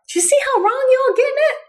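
high, fast, put-on scolding voice; mock scolding. Trainer teasing dog owners in a mock scolding voice.

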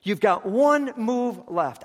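loud, high, slow urgent call; shout. Retold or imitated. Chess master shouting to the young man. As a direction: cry out urgently, slowly. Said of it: "He yelled out".

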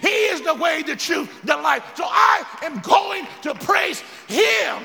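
loud, high, shouted preaching. Real, not acted. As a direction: preach it at full force. Preaching at full force with music.

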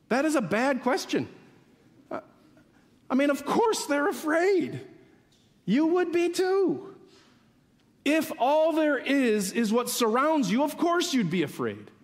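high, forceful, incredulous preaching; impassioned protest. Real, not acted. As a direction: pitch it up and protest the question hard. Impassioned protest at Jesus' question.

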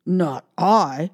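gruff dog voice; barking dog voice. Acted. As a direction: bark it like a dog. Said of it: "barked the dog".

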